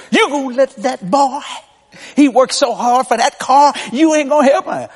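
high, pushy mom voice; mother pleading. Retold or imitated. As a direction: nag dad on the son's behalf. Mom pleading with dad, retold.

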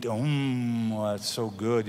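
low, soft moan of food appreciation; hushed, low undertone of enjoyment; talking to oneself. Acted. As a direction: murmur it low to yourself while savoring a bite. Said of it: "You're talking to yourself about it".

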